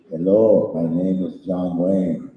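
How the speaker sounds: deadpan non-impression of a cowboy star; John Wayne. Shown as a demonstration. Joke impression for the audience, lightly voiced John Wayne. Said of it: "Here's my impression of John Wayne".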